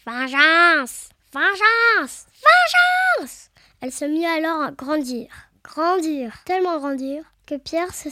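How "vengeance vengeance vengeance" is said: furious witch's cry climbing to a very high shriek; each cry higher, long drawn and falling at the end; cried out, building. A narrator's reading. Enraged witch cries for revenge on Pierre, building to a shriek. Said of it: "Puis elle s'écria".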